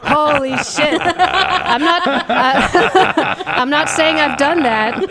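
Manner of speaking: cracking up while talking, pitched high; real laughter breaking into the words; laughing. Real, not acted. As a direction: laugh your way through an embarrassing confession. Cracking up at her own confession.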